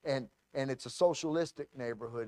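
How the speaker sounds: usual speaking voice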